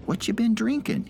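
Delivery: put-on high, bright curious old man; sparkling interest. A narrator's reading. Old man asking the drunk eagerly. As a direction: ask like it truly delights you. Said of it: "his eyes sparkling with interest".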